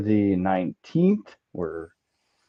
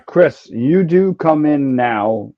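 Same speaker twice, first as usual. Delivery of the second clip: normal talking voice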